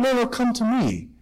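a hint of the devil luring someone over; tempting devil. Devil mimicking God's invitation, lightly tempting. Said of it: "what does sin do and the devil do".

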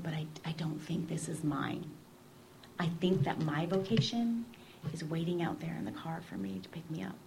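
a soft, hushed admission; whispered confession. Retold or imitated. Young woman telling her sister her vocation waits in the car, whispered. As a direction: whisper it like a secret.

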